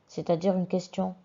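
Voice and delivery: ordinary delivery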